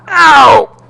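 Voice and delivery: sudden high, loud yelp of pain. Acted. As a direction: yelp as you crash to the ground. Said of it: "I remarked".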